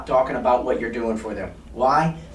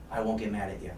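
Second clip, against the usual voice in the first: soft punchline; 5 semitones lower, 8 dB quieter than usual. Shown as a demonstration. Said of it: "I'll be very stern in my voice".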